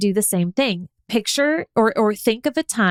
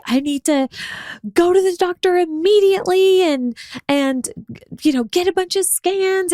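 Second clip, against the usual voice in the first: mock panic about needing scans; mock freak-out; 9 semitones higher than usual. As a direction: play up a frantic health scare. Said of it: "no freaking out".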